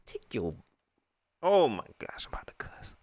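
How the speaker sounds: whispered, barely holding it in; exasperated whisper. Real, not acted. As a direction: whisper it to yourself, close to losing your temper. Holding himself back from cussing.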